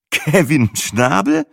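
slow, rising disbelief; incredulous echo. A narrator's reading. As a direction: repeat the name as if it can't be real. Matze echoes the odd name.

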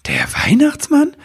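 very high, incredulous question; amazed squeak; astonished. A narrator's reading. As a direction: ask in sheer amazement. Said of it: "fragte Nibelwurz verwundert".

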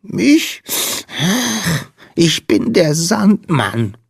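high, slow, tiny sniffly voice; sniffling little creature. A narrator's reading. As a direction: squeak it slowly through a sniffle. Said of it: "schniefte der Wicht". (hohe, schniefende Wichtelstimme)

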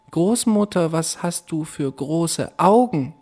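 put-on girl staring at the wolf in bed; wondering girl. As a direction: ask it wide-eyed and uneasy. Rotkäppchen wonders again.

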